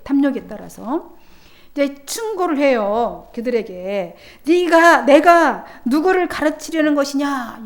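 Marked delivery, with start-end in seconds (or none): 4.4-7.6 loud, higher indignant retort; loud mocking challenge; scornful retort. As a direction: snap back at the prophet in contempt. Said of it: "이렇게 하는 거예요".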